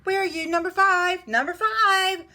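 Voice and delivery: loud, high mother's call. A narrator's reading. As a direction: holler for a missing child. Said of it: "Missus Duck was on her doorstep calling".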